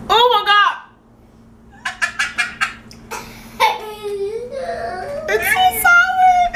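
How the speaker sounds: high shriek of disgust, drawn out; sour juice reaction. Real, not acted. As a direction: yelp at the sour taste. Drinking sour green juice.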